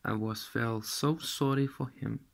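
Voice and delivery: ordinary delivery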